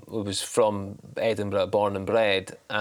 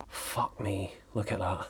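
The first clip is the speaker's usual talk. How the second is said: much softer, hushed amazement; quiet awe; 2 semitones lower, 11 dB quieter than usual. Retold or imitated. Driver pulled over at a stunning view, quietly awestruck. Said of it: "I still pull over at the side of the road and go".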